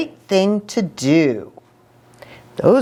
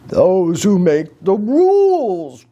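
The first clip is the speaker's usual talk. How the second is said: growled. Acted. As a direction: growl it like a gruff dog. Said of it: "growled Pushkin".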